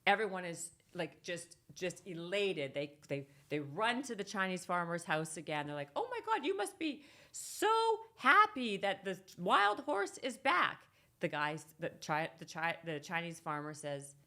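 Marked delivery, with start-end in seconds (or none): 6.0-10.8 much higher, gushing villager voice; excited neighbors. Retold or imitated. Neighbors rushing to the farmer, a high gushing squeal.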